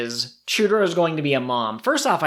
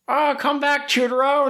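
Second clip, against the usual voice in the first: much higher, whiny little puppy voice; pleading puppy; 12 semitones higher than usual. Retold or imitated. Host voicing the puppy calling Chutaro back, high whiny voice. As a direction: call after him like a tiny pup. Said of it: "the puppy being like".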